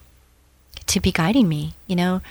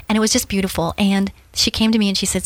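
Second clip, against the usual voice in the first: ordinary talk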